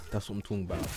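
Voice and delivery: normal talking voice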